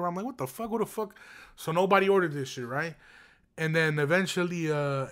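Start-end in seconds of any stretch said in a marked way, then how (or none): 0.2-1.1 softer, high-pitched retold bewilderment; baffled disbelief. Manager finding nobody ordered it, baffled disbelief. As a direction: puzzle aloud in a high, hushed voice.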